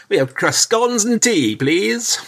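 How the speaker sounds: high, mock upper-class English; very posh English accent. Englishman abroad ordering scones and tea, in a hammed-up posh accent. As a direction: put on posh English, pitched right up. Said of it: "Either very posh".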